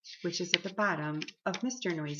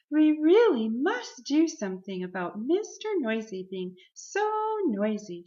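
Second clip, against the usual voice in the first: emphatic complaint; 6 semitones higher, 5 dB louder than usual. A narrator's reading. Shopkeeper complains about noisy customer.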